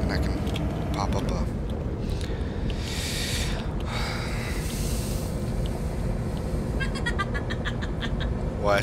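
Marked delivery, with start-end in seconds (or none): 1.5-8.6 high breathless cackle; cackling laugh. Real, not acted. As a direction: cackle away, almost silent. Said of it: "She's cackling next to me".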